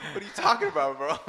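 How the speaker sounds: laughing. Real, not acted. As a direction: play innocent while cracking up. Laughing while retelling denial.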